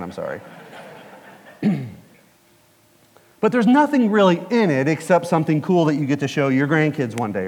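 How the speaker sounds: usual speaking voice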